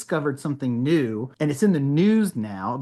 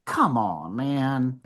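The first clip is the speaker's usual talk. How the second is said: weary protest; 3 semitones lower, much slower than usual. Retold or imitated. Retold weary protest at being stopped.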